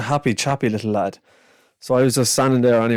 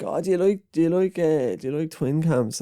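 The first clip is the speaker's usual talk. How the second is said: put-on higher, cheeky young Dublin kid voice; 6 semitones higher than usual. Narrator quotes the cheeky Dublin boy, higher and chirpy. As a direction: play a chatty seven-year-old. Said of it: "he was like".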